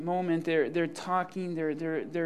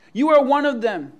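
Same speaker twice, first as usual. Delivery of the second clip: raised, emphatic accusing voice; loud accusation; 6 semitones higher, 11 dB louder than usual. Acted. Courtyard accuser singles out Peter, loud and accusing. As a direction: point the finger with your voice.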